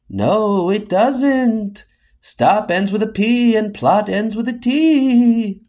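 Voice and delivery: high, sing-out spooky voice; eerie high-pitched objection. Acted. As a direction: correct them like a haunting phantom. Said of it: "not in that spooky voice".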